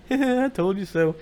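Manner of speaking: playful. Shown as a demonstration. Husband can be playing with wife, playful laughing taunt. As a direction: laugh and sing it out teasingly. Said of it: "You can be playing like".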